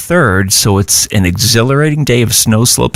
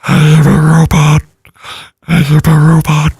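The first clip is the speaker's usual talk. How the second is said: booming metallic robot; flat mechanical voice, breathed in backwards; 7 semitones higher, 3 dB louder than usual. Shown as a demonstration. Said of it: "I could do the robot voice".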